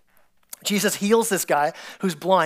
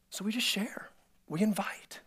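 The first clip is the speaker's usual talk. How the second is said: hushed, whispered point; 12 dB quieter than usual. Real, not acted. Pastor whispering his point to the congregation. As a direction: drop to a whisper to land it.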